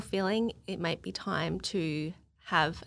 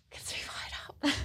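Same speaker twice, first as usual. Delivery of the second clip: breathy whisper after a sigh; pondering whisper; 8 dB quieter than usual. Real, not acted. Guest mulling over the host's question, pondering whisper. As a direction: whisper it to yourself.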